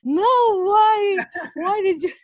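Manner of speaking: high, loud wail of disbelief; dramatic dismay. Retold or imitated. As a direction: cry out in mock anguish over the dog. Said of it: "Tapi kalau anjing mati kayak". (meratap dramatis, tinggi dan keras)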